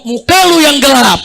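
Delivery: loud coffee-shop comeback; mock retort. Friend at a coffee stall hits back at an insult, loud mock retort.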